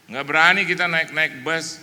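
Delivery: ordinary delivery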